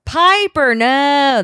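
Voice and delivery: high, hammed-up plea; mock dramatic cry. Host mimics a character's line for co-host, mock dramatic cry. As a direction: call it out in mock alarm.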